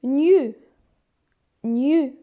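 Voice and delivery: gnu said loudly, ny plus a rounded u; syllable demo. Shown as a demonstration. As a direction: blend gnu clearly. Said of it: "se prononce".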